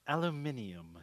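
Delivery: "Aluminium" with its extra i, al-u-MIN-ee-um; British pronunciation. Retold or imitated. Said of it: "they'll say it weird".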